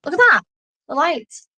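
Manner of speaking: high, loud surprised call; surprised exclamation. Real, not acted. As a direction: blurt it out surprised. Reacting to the lights.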